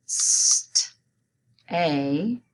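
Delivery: "S, t, a" as isolated sounds with a gap before a; sounding out. Shown as a demonstration. Teacher while students write staying, sounding out "S, t, a" separately. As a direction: say "S, t, a" one sound at a time. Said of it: "Go ahead and write it".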